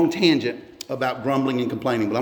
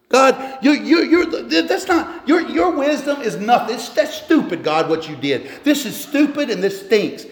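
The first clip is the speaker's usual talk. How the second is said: high-pitched, stammering protest; hammed-up indignant grumbler; mock complaint; 8 semitones higher than usual. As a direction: gripe incredulously up high, half laughing. Voices a complainer scolding God.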